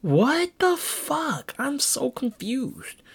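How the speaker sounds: high, slow, drawn-out dismay; stunned disbelief. Retold or imitated. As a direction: stretch it out, baffled and high. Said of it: "So I was like".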